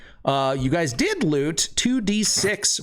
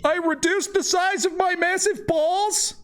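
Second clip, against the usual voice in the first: very high, squealing character voice; excited mage. Acted. Transformed mage greeting returning adventurers, high excited squeal. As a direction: cry it out far above your own pitch. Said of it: "And he's like".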